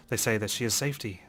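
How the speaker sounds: ordinary talk, nothing marked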